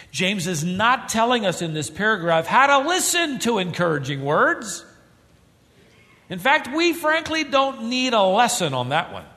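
high, loud pulpit fervour; forceful preaching. Acted. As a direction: drive the point home to the congregation.

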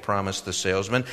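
ordinary delivery, nothing marked

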